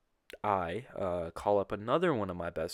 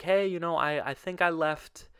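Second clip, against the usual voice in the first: raised, louder voice re-enacting a request; higher-pitched retold "hey"; retold polite approach; 8 semitones higher, 5 dB louder than usual. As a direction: act out hailing the desk clerk. Calling on the front desk.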